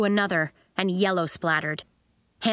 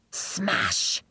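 smash sound effect; 11 semitones lower than usual. A narrator's reading.

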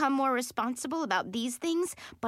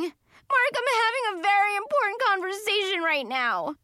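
tearful indignation; 10 semitones higher, 4 dB louder than usual. A narrator's reading. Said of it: "still crying".

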